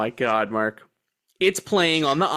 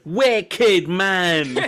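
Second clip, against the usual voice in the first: higher, louder mock British kid accent; chirpy British hipster accent, hammed up; 4 semitones higher, 4 dB louder than usual. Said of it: "a little British guy came out and he was like".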